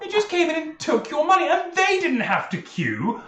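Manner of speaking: high, loud indignant outburst; outraged Tyndall. Acted. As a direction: squawk it out in disbelief. Tyndall outraged at being robbed.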